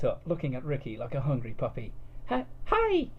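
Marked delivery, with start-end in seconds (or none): 2.3-3.1 put-on high, loud squeaky girl voice; an over-eager anime girl, high and loud; excited anime girl. A narrator's reading. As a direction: sputter it out like a hyper cartoon girl. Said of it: "she sputtered".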